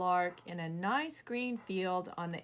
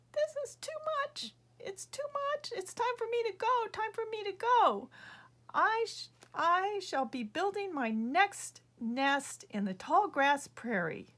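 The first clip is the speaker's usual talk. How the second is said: very high-pitched bird voice, fed up; high bird voice announcing she's leaving; upset little bird; 12 semitones higher than usual. Acted. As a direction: squeak out that enough is enough.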